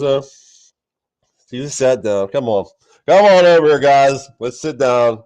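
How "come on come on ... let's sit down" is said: calling the team over. Retold or imitated. Jesus calling disciples over.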